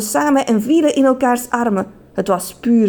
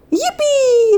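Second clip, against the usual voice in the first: very high, louder cheer; joyful whoop; 4 dB louder than usual. A narrator's reading. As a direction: whoop for joy. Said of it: "riep meneer de Just".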